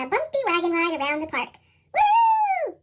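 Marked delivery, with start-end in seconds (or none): 1.9-2.8 high, loud whoop of joy; joyful child's woo; excited shout. A narrator's reading. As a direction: whoop it out on the bumpy ride. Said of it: "Pete shouts".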